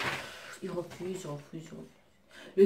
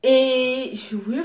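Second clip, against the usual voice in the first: usual speaking voice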